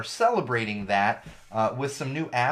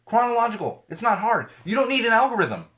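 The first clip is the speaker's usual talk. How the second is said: high, loud insistence; exasperated rant; 10 semitones higher, 6 dB louder than usual. Real, not acted. Host demanding a chronological feed: high, loud, fed up. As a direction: hammer the point home. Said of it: "ranting for chronological feed".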